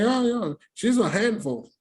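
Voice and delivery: high-pitched, stammering impression; imitating the husband. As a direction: imitate a flustered man in a high voice. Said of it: "He start talking. He's like".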